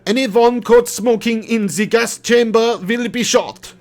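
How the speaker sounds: put-on a clumsy stab at a German accent; hammed-up German accent barked slowly; bad German accent. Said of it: "I can't do the German accent".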